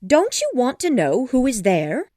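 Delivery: exasperated, eager wife voice; impatient. A narrator's reading. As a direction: press him impatiently for a reaction. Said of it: "cried his wife impatiently".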